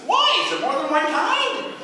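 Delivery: high, loud, whiny Stooge; Curly impression. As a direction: whine back like Curly. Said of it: "And he says".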